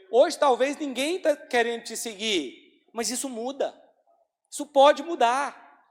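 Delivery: loud, slow preaching, pressing each phrase; urgent exhortation. Acted. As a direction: lean in and urge the flock. Exortação pregada. (exortação forte e pausada)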